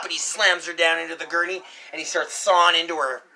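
plain reading voice

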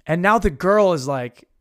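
ordinary delivery

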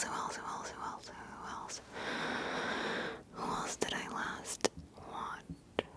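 repetitive, barely audible whispering; faint musing whisper. Real, not acted. Vlogger trying to remember who they watched, whispering faintly. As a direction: think aloud in the tiniest whisper.

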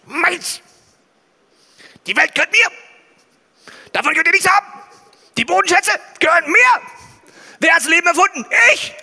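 booming, high and loud tyrant; dark lord. Pretend. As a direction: roar it like a greedy overlord. Imagined greedy God claiming everything.

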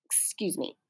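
ordinary delivery, nothing marked

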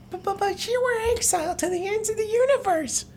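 very high squeaky falsetto stammer; Slippy. Acted. Slippy interrupts the villain's tale, squeaky falsetto protest. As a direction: squeal the protest like a cartoon toad.

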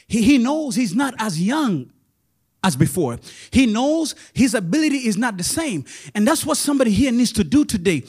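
loud pulpit preaching. Acted. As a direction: press the point home loudly. Preaching at full force.

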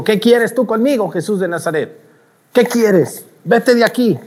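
high, irritated demon confronting Jesus; annoyed devil. Acted. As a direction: send him away in high-pitched annoyance.

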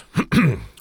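loud throat clear. Acted. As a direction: clear the throat before speaking. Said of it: "Harry cleared his throat".

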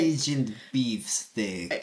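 slow, half-hearted Russian agent voice; half Russian accent. Acted. Introducing oneself as Agent Beefsteak, half Russian accent. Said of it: "I can only do a half Russian accent".

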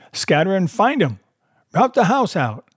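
high-pitched repeated order; reiterated command. A narrator's reading. Blind man orders his men to search, reiterated command. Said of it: "reiterated Pew".

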